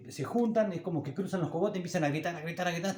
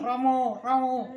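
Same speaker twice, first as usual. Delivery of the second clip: high, louder mock penguin cry; female penguin call; 10 semitones higher, 7 dB louder, slower than usual. Grandfather imitating the female penguins answering, high louder cry. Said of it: "las hembras que escuchan".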